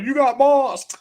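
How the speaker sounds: high, loud laughing taunt; hammed-up trash talk with a laugh; mock taunt. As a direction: gloat at the groom through a laugh. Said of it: "and be like".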